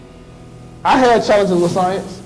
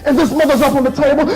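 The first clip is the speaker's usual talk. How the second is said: normal talking voice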